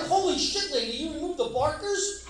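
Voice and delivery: high, loud laughing outrage; shocked disbelief. Retold or imitated. Comic retells his reply to the lady, shocked and high. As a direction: act floored by the news, high and loud. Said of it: "I'm like".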